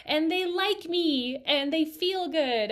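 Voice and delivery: high, slow, loud singsong cheer; gleeful celebration. Retold or imitated. Stylist after finishing a service, gleeful high cheer. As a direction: stretch the words out in childlike delight. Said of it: "you get that feeling of".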